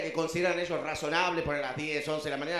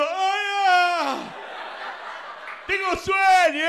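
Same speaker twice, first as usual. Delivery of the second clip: much higher, loud hammed-up sleepy yell; groggy loud shout; 11 semitones higher, 7 dB louder, much slower than usual. How the caller hears the sleepy comedian, loud hammed-up groggy yell. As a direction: blurt it out like you just woke up. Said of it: "el otro lado escucha tipo".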